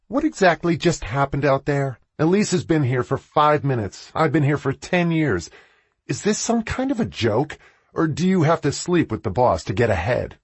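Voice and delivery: angry confrontation. Acted. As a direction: hurl the accusations at the boss. Confronting her boss in anger.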